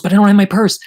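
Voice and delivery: high, loud imitated protest; protesting friend. Friend objects to the cover story, loudly protesting. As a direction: act out a baffled objection.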